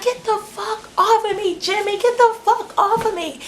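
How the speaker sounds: shrieky retold protest, volume held back; imitated screaming. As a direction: squeal it like a girl struggling free. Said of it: "And she's screaming".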